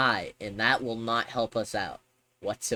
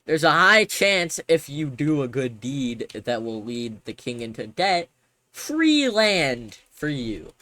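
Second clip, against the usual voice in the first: excited pitch; 3 semitones higher, 7 dB louder than usual. Acted. Grand sales pitch.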